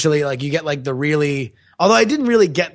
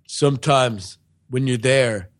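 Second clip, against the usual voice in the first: mock movie-hero monologue; 6 semitones lower than usual. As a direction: intone it gravely, with long pauses. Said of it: "the main character, like".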